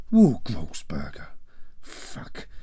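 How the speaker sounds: low, slow, fading to an unvoiced curse; father crushed, head hanging between his legs; defeated muttering. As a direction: sink into despair. Father slumped, head in hands.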